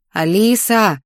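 drawn-out, insistent call of the name. A narrator's reading. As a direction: stretch the name out to rouse them. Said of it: "только уже настойчивее".

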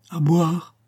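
petite voix haute d'enfant qui réclame; enfant malade. A narrator's reading. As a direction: demander comme une fillette fiévreuse. L'enfant malade réclame à boire. (voix aiguë d'enfant malade)

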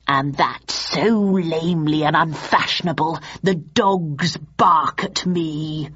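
drawn-out villainous declamation; slow right down towards the end; Richard III. A narrator's reading. Richard cueing the audience to bark, declaiming.

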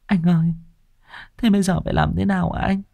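high, soft, near tears; a frightened wife whimpering. Acted. Wife whimpers to her husband, high and tearful. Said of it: "mếu máo hỏi chồng".

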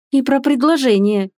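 mildly spiteful, pointed; vindictive. A narrator's reading. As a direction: add it with a little relish of revenge. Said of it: "мстительно добавила Элизабет".

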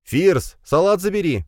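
high-pitched motherly call. A narrator's reading. Mother calls her son from the kitchen, calling out. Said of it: "раздался голос матери с кухни".

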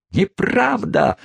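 high, drawn-out indignant cry; shrieked denial. A narrator's reading. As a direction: wail the denial in outrage. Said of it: "завопила в ответ незамужняя тётушка".

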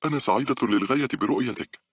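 high, delighted greeting; happy interruption. A narrator's reading. Paula cuts in to greet Carlos, very happy. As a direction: cut in with eager, high-pitched joy. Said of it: "قطعتها باولا قائلة".

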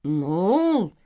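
drawn-out, faltering hedge; mildly sheepish hesitation; hesitant. A narrator's reading. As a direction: stall a little before admitting it. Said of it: "слегка замялась Селия".

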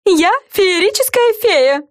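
high, slow showy proclamation; theatrical. A narrator's reading. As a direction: proclaim grandly, head tossed. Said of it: "закричала Френ и картинно вскинула голову".

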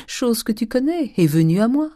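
sly, gloating vintner's voice; gleeful old miser. A narrator's reading. Old Grandet gloating to his wife over the wine sale. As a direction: boast to your wife in triumph.

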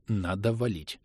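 soft rustling thought; rustling inner voice. Acted. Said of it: "прошелестело в голове".

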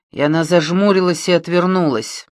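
usual speaking voice

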